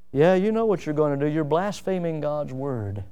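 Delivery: ordinary talk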